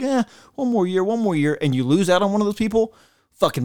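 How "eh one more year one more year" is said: mock lazy shrug of a veteran, pitched higher; mocking quarterback. Sports host mocking the quarterback who won't retire, pitched-up mock voice. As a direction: brush it off like the aging quarterback stalling.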